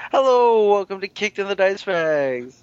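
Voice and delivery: high bright show-opener voice; big cheerful podcast welcome; peppy host greeting. Shown as a demonstration. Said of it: "where I'm just like".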